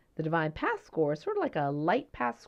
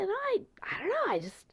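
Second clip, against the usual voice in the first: carefree mentee; 7 semitones higher than usual. Retold or imitated. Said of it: "He says".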